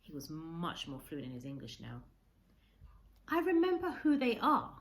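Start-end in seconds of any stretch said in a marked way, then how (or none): 3.3-4.8 put-on higher, louder old man's voice; an old man lost in memory, voiced up; Kensuke remembering. A narrator's reading. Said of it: "he said".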